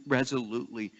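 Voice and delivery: normal talking voice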